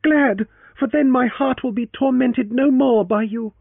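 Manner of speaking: put-on high, loud young woman's voice; a wounded young woman, bitter; heroine's voice. Heroine's parting reproach.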